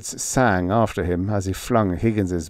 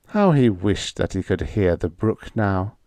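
usual speaking voice

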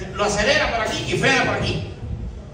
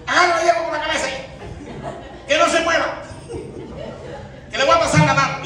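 higher-pitched instructions to a helper; acted orders to brother; 6 semitones higher than usual. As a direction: order your little brother about, pitched up. Gives brother orders in acted story. (dando órdenes con voz aguda)

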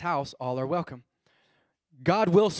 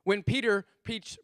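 ordinary talk, nothing marked

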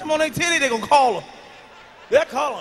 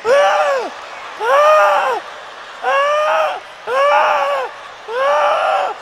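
high, loud choking gasps; mock gasping for air; 13 semitones higher, 5 dB louder, much slower than usual. Comic gasping for air while smothered between thighs. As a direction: gasp like you're suffocating.

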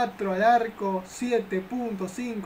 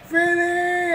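excited shout; 8 semitones higher, 6 dB louder than usual. Acted. Shot chance.

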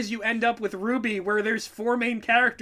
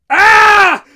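sudden high, loud yelp of fright; startled scream; 10 semitones higher, 16 dB louder than usual. Pretend. Mocking Lord Hater's reaction to a word, startled scream. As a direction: shriek like being jolted awake. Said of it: "Lord Hater jerked out of a nightmare".

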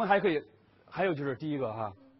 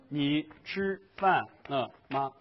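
each syllable said slowly and evenly, every one of 你吃饭了吗 given the same weight; 3 dB louder, much slower than usual. Shown as a demonstration. Teacher shows a student each syllable, slow and even. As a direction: spell it out syllable by syllable to show Chinese is monosyllabic. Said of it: "我们是单音节的".

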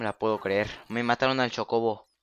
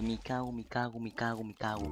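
muttered curses, much softer; cursing under breath; 8 dB quieter than usual. Real, not acted. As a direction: curse quietly to yourself. Muttered curses while fleeing.

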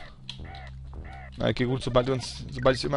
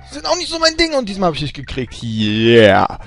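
hyped up; 4 semitones higher, 8 dB louder than usual. Real, not acted. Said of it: "ich bin total aufgedreht".

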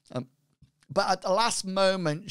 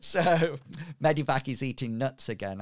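usual speaking voice

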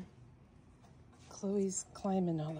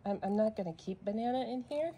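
ordinary talk

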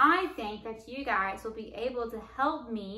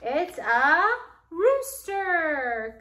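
excited reveal; 5 semitones higher, 6 dB louder, much slower than usual. Acted. Revealing answer to children.

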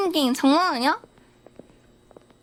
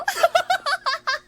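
nervous giggle; 11 semitones higher than usual. Real, not acted. Laughs after scare.

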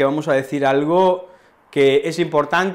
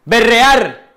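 shouted word; 9 semitones higher, 9 dB louder than usual. Shown as a demonstration. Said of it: "alguien grita".

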